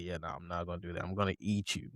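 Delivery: hushed, quick menacing demon voice; a quiet predator's threat, rattled off. Retold or imitated. Demon to the cop, a hushed fast menacing threat. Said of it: "He's like".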